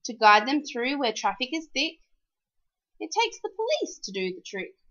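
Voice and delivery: ordinary delivery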